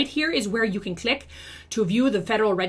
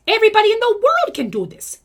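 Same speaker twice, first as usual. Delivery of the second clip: much higher and louder, a big rousing push; rising rallying cry; 10 semitones higher, 6 dB louder than usual. Acted. Rallying MLM reps to comment, much higher and louder. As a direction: lift it up high and loud like a battle cry. Builds to a bigger claim.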